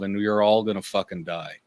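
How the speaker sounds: usual speaking voice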